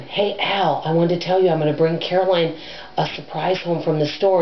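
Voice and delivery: ordinary talk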